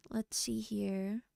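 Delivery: soft unvoiced aside; whispering. Real, not acted. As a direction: breathe it to yourself. Muttering while setting up music.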